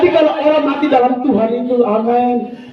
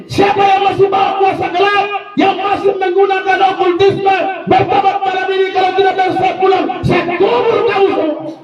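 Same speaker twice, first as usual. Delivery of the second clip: retold shouted challenge; 8 semitones higher than usual. Pastor challenging occult practitioners at a meeting, shouting. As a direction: thunder the threat at the sorcerers. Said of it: "saya bilang di sini".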